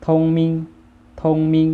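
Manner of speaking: ทงมิง as Vietnamese, high, level and loud; Vietnamese pronunciation. Shown as a demonstration. Teacher models the word for clever, Vietnamese pronunciation of ทงมิง. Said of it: "ภาษาเวียดอ่านว่า".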